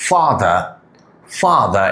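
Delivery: "father" with long open "aa", no final r; long AA sound. Shown as a demonstration. Teacher showing the vowel, "father" with long "aa". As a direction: say "father" as "faa-dha". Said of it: "উচ্চারণ হয়েছে আ sound".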